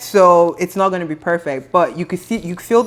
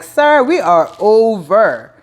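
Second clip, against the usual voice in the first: slow firm shutdown; 4 dB louder, much slower than usual. Retold or imitated. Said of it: "Portia is looking at him like".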